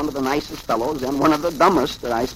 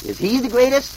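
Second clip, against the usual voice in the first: high, rising interruption; eager question; 11 semitones higher, 4 dB louder than usual. A narrator's reading. Abner interrupts the couple, asking in a high voice.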